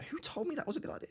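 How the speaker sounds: breathy rueful whisper, a bit fast. Real, not acted. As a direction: hiss it under your breath. Rueful aside to chat after transfers.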